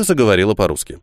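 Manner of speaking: ordinary delivery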